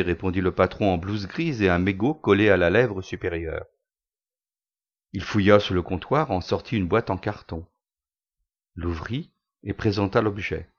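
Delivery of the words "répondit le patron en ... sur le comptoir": plain reading voice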